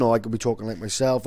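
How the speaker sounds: normal talking voice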